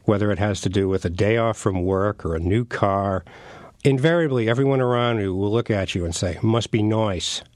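North Country New Hampshire accent, "nice" turned to "noise". Shown as a demonstration. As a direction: put on a folksy New Hampshire logger's accent. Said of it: "Can you do the accent?".

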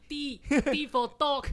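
slow, loud, high spelling with "dog" sounding like "tok"; Indian accent spelling. Retold or imitated. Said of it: "拼错我都会拼错".

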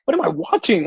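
can't believe what's on the screen; retold disbelief.

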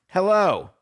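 high, loud sing-song hello; mock cheery hello. Writing a mock offer, a high cheery hello. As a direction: greet the player with a bright, high hello. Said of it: "I'm writing one right now".

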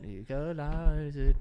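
slow, drawn-out mock Jamaican accent; a lazy, stretched mock Jamaican accent. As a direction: lean into a put-on Jamaican accent, slowly. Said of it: "certain accents we shouldn't do".

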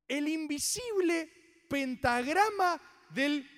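very slow, word-by-word savouring; emphatic recitation. Acted. Repeating the line for doubters, slow emphatic recitation. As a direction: hold each word up for admiration.

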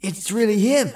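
breathless with amazement; awed boy voice. A narrator's reading. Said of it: "Chris whispered in awe".

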